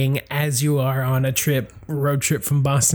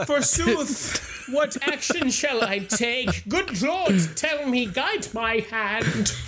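hammy stage actor; 7 semitones higher, slower than usual. Pretend. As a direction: ham it up like an old stage tragedian. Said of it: "I doubt a kid said, "What shall I do?".

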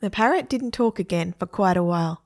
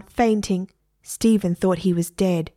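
usual speaking voice

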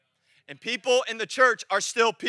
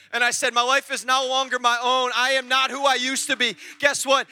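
driving, declarative sermon climax; full-force preaching; 3 semitones higher, 6 dB louder than usual. Real, not acted. Preacher declaring he walks in freedom, full-force preaching. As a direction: proclaim it with steady force.

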